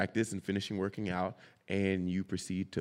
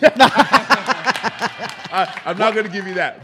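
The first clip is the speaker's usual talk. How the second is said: excited; 11 semitones higher, 12 dB louder, much slower than usual. Real, not acted. Laughing refusal to name teammates.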